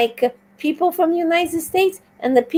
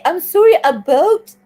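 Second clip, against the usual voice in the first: put-on Canadian accent; 5 semitones higher, 4 dB louder than usual. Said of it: "if they say".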